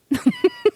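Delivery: sudden high giggle; real high-pitched laugh; high burst of laughter. Real, not acted. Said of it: "[name]'s laughing".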